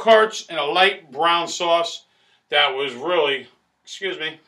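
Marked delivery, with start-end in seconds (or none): none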